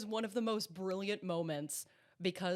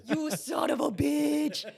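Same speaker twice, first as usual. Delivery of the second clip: high, slow, hollered accusation; gleeful mock shout; 8 semitones higher, 4 dB louder, slower than usual. Host realizes Ezra's trick, gleeful mock shout. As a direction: holler it high, stretching every word.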